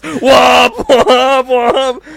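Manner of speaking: loud, high honking shouts; imitated car horn. Storyteller mimicking his horn while driving, imitated loud honking. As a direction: blast out horn honks over laughter.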